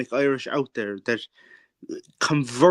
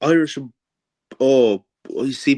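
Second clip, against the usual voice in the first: normal talking voice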